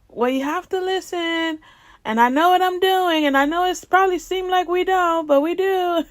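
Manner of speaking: high, insistent mock instructor voice; imitating the instructor. Retold instructor insisting he knows.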